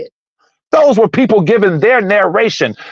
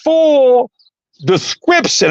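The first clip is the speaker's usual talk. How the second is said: heated, pitched up, pressing the point; raised voice; 7 semitones higher than usual. Real, not acted. Host scolding his co-host Jojo, raised and heated. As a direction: push it at him like you're fed up. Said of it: "I'm sorry for raising my voice".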